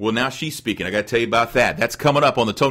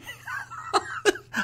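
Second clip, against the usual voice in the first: high laugh; 9 dB quieter than usual. Real, not acted. Laughing at his own joke.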